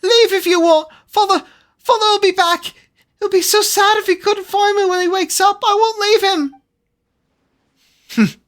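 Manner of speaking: put-on high, pleading child crying out; a desperate kid refusing to leave.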